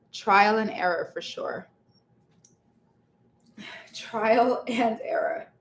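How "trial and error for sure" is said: usual speaking voice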